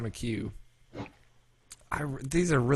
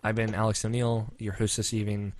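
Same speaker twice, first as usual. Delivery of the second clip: usual speaking voice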